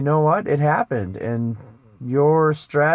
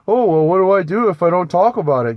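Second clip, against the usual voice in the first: quick, louder, higher mocking whine of a fretful viewer; mock worried viewer; 4 semitones higher, 6 dB louder, faster than usual. Preacher mocking a fretful viewer's objection, quick and loud. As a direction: parrot the anxious objection, hammed up and fast.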